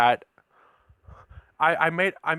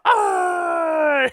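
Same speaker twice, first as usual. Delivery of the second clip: long, high, loud comic scream; imitated intro yell; 5 dB louder than usual. Spinning on the intro pole, imitated high yell. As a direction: shriek as if whirling round a pole. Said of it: "he was spinning around on that pole".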